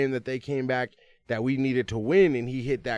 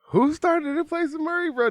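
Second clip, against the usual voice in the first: very high, loud, incredulous squeal; worked-up demand; 5 dB louder than usual. Real, not acted. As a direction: shout the question in hyped disbelief. Repeats question louder, insistently.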